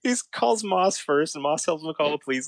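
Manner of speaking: ordinary delivery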